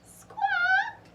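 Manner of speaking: high, shrill parrot call; parrot squawk. Acted. Polly the parrot cries out, high squawk.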